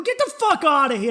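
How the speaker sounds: loud, high, incredulous shout; retold disbelief. Acted disbelieving reply.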